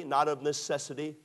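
ordinary delivery

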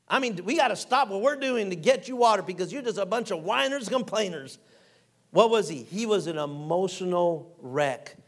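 usual speaking voice

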